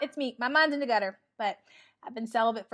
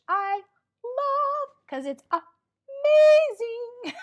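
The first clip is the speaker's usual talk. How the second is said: high, slow and louder gush; 13 semitones higher, 6 dB louder, much slower than usual. Real, not acted. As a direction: sing it out with delight. Gushing about cross training.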